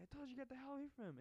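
high, rushed mock warning; fast, hammed-up high-pitched order; Loomis. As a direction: scold urgently in an imitated high voice.

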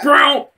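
high, loud saber swish; imitated lightsaber. Guessing how Darth Maul was cut, imitated lightsaber noise. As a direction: mouth a quick saber swing.

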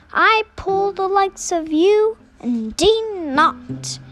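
looking down on the little train; haughty refusal. Acted. Passenger engine's haughty refusal.

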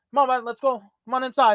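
coaxing owner calling his husky in; calling the dog. Retold or imitated. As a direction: call him up the stairs.

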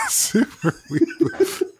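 laughing. Real, not acted.

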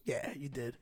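low, very soft comeback; retold quiet retort. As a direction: drop it low and quiet after the protest. Guard's deadpan reply acted out.

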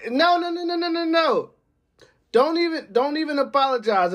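rising to a high, emphatic string of no's. Real, not acted. Podcaster refusing a fake apology, high emphatic no. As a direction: shut it down, way up high.

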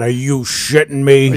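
exasperated dad. Retold or imitated. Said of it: "And my dad".

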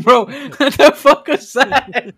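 high, loud words through laughter; laughing hard. Real, not acted. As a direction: crack up while getting it out. Cracking up at friend's noise.

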